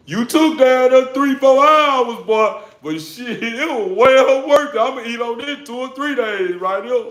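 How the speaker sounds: high and loud, laughing; excited self-praise. Retold or imitated. A cook boasting about his four-hour meal, high and excited. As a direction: crow with delight over the meal.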